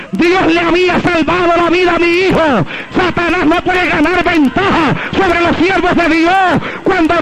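high, sustained pulpit proclamation; triumphant preaching. Real, not acted. Preacher tells the church his son breathes, triumphant high preaching. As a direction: proclaim the victory with stretched-out phrase endings.

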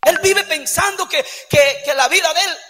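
loud, impassioned sermon delivery; fiery preaching. Acted. Pastor preaching hard to the church, impassioned shout. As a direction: preach it with fire to the congregation.

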